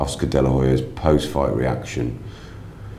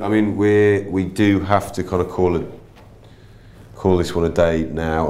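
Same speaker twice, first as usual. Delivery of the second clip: ordinary delivery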